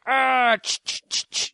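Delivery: an "ah", then quick hissed scrubbing bursts; imitated scrubbing noises. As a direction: mimic scrubbing with short voiceless "ch" sounds. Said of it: "they're like".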